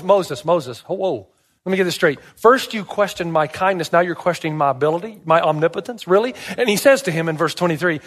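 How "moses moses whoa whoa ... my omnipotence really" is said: ordinary delivery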